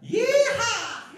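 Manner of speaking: high-pitched rallying "Yeehaw!"; shouted yeehaw. Shown as a demonstration. Singer shows the crowd what to shout, shouted yeehaw. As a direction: whoop it out loud and high. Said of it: "all together sing or shout out".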